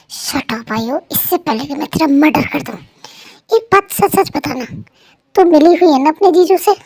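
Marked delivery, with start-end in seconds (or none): 3.5-6.7 loud, suspicious demand; accusing. Acted. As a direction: corner her and make her confess. Wife accusing friend.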